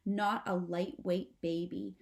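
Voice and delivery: ordinary delivery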